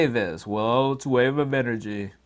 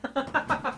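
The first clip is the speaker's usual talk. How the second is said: soft, high little giggle; laugh; 13 semitones higher, 6 dB quieter than usual. Real, not acted. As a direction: giggle softly to yourself. Last laugh while signing off.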